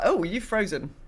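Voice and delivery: ordinary delivery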